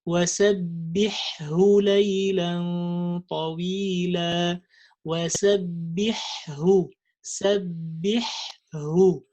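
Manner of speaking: وَسَبِّحْهُ with both ha sounds clear; clear double ha. Shown as a demonstration. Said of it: "And the other one".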